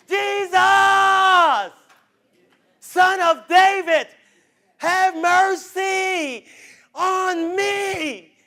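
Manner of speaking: high, slow, shouted plea; crying out for mercy. Retold or imitated. Blind man crying out to Jesus, slow shouted plea. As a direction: beg loudly across a crowd. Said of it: "I gotta say it again".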